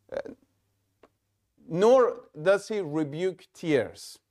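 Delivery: ordinary talk, nothing marked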